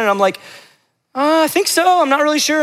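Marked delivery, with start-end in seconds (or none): 1.2-2.6 higher, hesitant, caught off guard; mock-nervous reply to friends about girlfriend; flustered unsure answer. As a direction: squeak it out, unsure of yourself.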